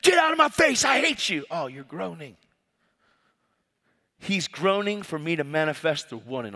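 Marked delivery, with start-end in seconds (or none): 0.0-1.4 high-pitched, strained mock rant; angry outburst. Angry stranger rejecting the preacher, acted high outburst. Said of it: "People are angry". 1.5-2.4 soft, unruffled reply; gentle realization. Acted. Preacher answering an angry person, soft and understanding.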